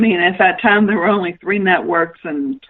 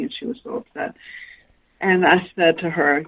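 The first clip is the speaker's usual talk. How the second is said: usual speaking voice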